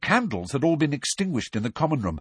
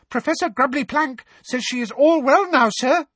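very high, louder elf squeak; a devoted little elf, squeaking proudly; squeaky elf; 4 dB louder than usual. Acted. Dobby reporting to Harry about his owl, squeaky and proud.

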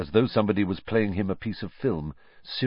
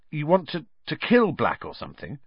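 tense Ron; 9 semitones higher than usual. A narrator's reading. Ron voiced high.

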